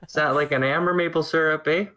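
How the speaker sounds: put-on playful Canadian accent asking about syrup; mock Canadian accent. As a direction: ask about maple syrup like a caricature Canadian. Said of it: "Part of me died in that dialogue".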